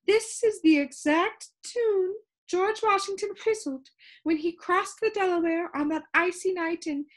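a fussy, lilting lady with static in her; complaining sound keeper. Acted. Sound Keeper answering the visitor, high and complaining.

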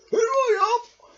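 very high, loud toy bear voice; high cartoon bear; squeaky bear calling. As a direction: call out cheerfully. Bear calls from dump.

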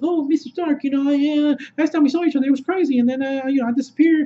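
put-on high, rambling kid voice; over-explain in a high-pitched babble; Peter Parker. Kid over-explaining to his mentor, high babbling impression. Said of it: "you know how Peter Parker is. He's always trying to over-explain".

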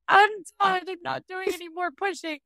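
high, melodramatic whine of giving up; dramatic quitter. Retold or imitated. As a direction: play the exhausted mom who is done pushing. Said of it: "And I was so dramatic".

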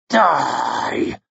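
drawn-out, hate-filled death wish; murderous snarl. A narrator's reading. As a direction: force it out as you strike. Logan battering his enemy to death.

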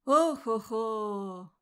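slow drawn-out groaning sigh; weary old man's sigh. A narrator's reading. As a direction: heave a long tired old sigh. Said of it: "вздохнул кто-то из стариков".

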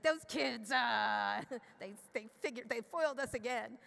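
hammed-up cartoon crook grumbling about meddling kids; Scooby-Doo villain. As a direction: ham up the busted bad guy with a laugh. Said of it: "it's like the Scooby-Doo kids".

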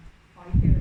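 loud imitated plea to the spirits; mock vow. Thais pray to spirits for lost things, loud mock plea. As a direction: call out the vow to the spirits loudly. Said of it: "ถ้าหาอะไรไม่ได้ดังใจก็บน".

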